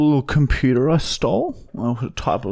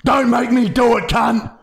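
hammed-up furious threat on the phone; building mock rage; 9 semitones higher than usual. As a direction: wind up from threat to yelling. Prank caller escalating threats.

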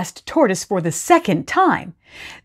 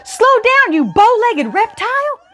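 high, loud desperate scream; 3 dB louder than usual. Acted. Hare chasing Tortoise to the line, screaming high. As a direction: scream after him as he escapes. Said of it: "screamed Hare".

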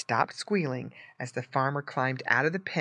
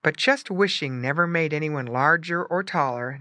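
plain reading voice

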